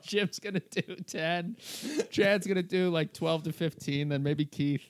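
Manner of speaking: laughing retelling. Retold or imitated. Comic retelling the manager's shrinking lineup to podcast host, laughing. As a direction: crack up while listing the times again. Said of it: "He keeps going down".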